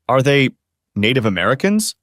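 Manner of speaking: ordinary delivery, nothing marked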